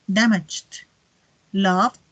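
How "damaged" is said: "damaged" ending in a soft d, almost t; soft final D. Shown as a demonstration. Teacher drills past forms, "damaged" with soft final stop. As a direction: close "damaged" on a gentle stop. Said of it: "pero más suavecita".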